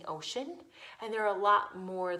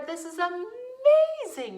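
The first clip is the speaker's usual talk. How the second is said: much higher, slow, marvelling; gushing praise; 12 semitones higher, 8 dB louder than usual. Real, not acted. Teacher marvelling at kids who never crab walked, gushing. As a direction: marvel at them out loud.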